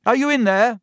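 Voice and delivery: high, loud shout through a door; calling out. A narrator's reading.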